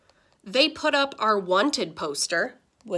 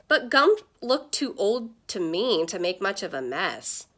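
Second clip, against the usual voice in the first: ordinary delivery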